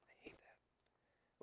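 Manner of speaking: slow whispered aside; whispered grumble to yourself. Real, not acted. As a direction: hiss it under your breath. Grumbled aside about a wrestler.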